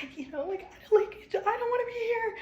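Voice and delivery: high, loud voice cracking with distress; breaking down. Retold or imitated. Mother recalling her breakdown after her grandma died, crying out high and loud. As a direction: let the voice climb and break in despair. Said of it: "breaking down".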